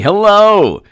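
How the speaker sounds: high, loud gleeful yelling; excited shout-out. Real, not acted. Host hyping viewer names, loud and high. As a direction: whoop it up for the viewers.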